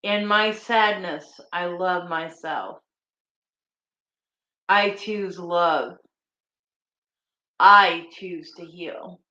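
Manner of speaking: slow, earnest affirmation voice; healing affirmations. Acted. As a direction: speak each line as a vow to yourself. Reciting healing affirmations slowly.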